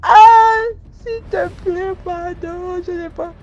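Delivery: high, crying begging; begging the man for forgiveness, high crying plea; tearful pleading. Retold or imitated. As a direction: beg through tears, voice up high. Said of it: "me mettre à pleurer".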